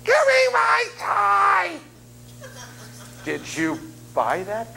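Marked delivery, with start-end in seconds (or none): 0.0-1.8 put-on high, slow shouted child's demand; whiny child. Selfish kid demanding his toy from the parent, high whiny yell.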